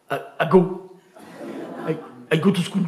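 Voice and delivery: clumsy French-accented "I go to school", laughing. Shown as a demonstration. Teacher imitating a French pupil, thick French accent. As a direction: play a stuck French pupil with "I, I go". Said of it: "En français, t'es là".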